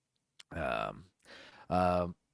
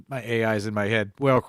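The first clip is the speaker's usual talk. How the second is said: ordinary talk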